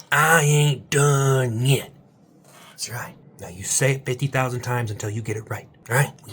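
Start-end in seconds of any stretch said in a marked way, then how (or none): 0.1-1.9 slow, loud, stretched defiance; defiant shout. Shown as a demonstration. Coach making viewers shout their defiant motto. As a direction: roar it out slowly. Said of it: "Say it with me or leave".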